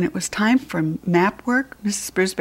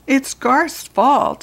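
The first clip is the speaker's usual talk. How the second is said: high, slow tattletale whine, louder; whiny blaming girl; 7 semitones higher, 5 dB louder, much slower than usual. Acted. Schoolgirl Heidi blames Garth to the teacher, high slow whine. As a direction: drag out the blame like a put-out schoolgirl.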